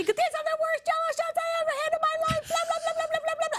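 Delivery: high, fast, whiny complaint; mock a sulky guest whining; whining complainer. Mocking the guest who hated the tequila shots, high fast whine. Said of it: "like a little whining bitch".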